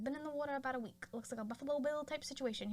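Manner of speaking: fast-talking vintage cop cadence; old movie detective. Retold or imitated. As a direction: rattle it off like an old film detective. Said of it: "sounds like an old detective".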